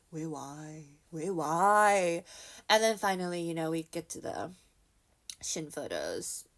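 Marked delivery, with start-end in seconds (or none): 0.1-0.9 very soft, far-away murmur; mock-lost, hushed questioning; small bewildered question. Replays own bewildered question at wall. 1.1-2.2 full-voiced, drawn-out why; insistent, hammed-up questioning; louder bewildered question. Same question repeated, building up.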